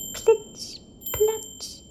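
very high, tiny splash noises; splash sound words. A narrator's reading. Narrator voicing the magic landing in a high squeak.

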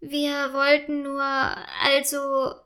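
sheepish, halting excuse; meek stammering. A narrator's reading. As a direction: trail into a hesitant excuse. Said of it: "stammelt Paula kleinlaut". (kleinlaut stammelnd)

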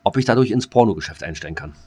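usual speaking voice

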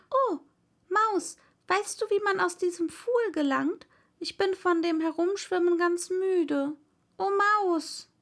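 put-on high, plaintive girl calling to the mouse; young girl's high plea; pleading young Alice.

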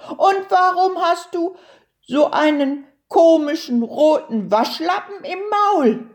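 high-pitched questioning turtle voice; curious little turtle. A narrator's reading. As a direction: ask up high, full of curiosity.

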